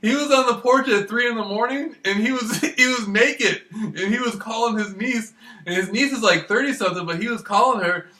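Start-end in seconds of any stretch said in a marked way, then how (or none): none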